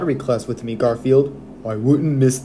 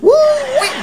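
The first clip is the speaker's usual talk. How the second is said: whooping; 5 dB louder than usual. Acted. Shoes too tight, whoop.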